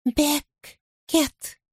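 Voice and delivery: a teasing whisper from under the bed; creepy playful under bed whisper. Acted. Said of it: "Тихий голос, шёпот из-под кровати".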